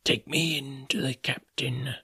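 slow menacing whisper; soft-spoken. A narrator's reading. Blind beggar ordering the boy in a whisper. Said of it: "soft-spoken".

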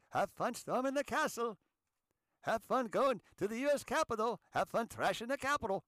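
high, sing-out sarcastic cheer; mock cheery send-off. Host sarcastically sends rioters off to the Capitol, mock-cheery. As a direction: wave them off with sarcastic gusto.

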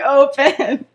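loud, laughing command; mock yell. Girl yelling at an empty piece of paper, mock yell. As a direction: yell it as a hammed-up order. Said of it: "she's just yelling at an empty piece of paper".

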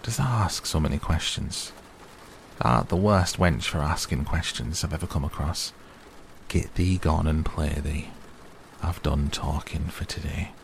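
ordinary delivery

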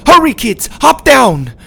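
loud, high commanding call; grandma barks an urgent order. A narrator's reading. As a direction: rush the kids down the wall. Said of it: "Grandma ordered".

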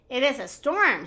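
plain reading voice

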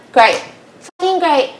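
bitter sarcastic "great". Retold or imitated. Husband seeing his wife cheat, bitter sarcasm. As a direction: spit out the fake praise.

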